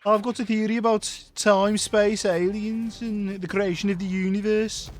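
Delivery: put-on high, slow, synthetic Brummie accent; Brummie Stephen Hawking. Imagining Stephen Hawking with a Brummie accent, high and slow. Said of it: "Brummie accent on his".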